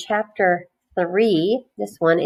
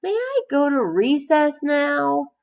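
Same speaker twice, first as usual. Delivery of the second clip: Joe begging; 8 semitones higher than usual. A narrator's reading. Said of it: "said Joe".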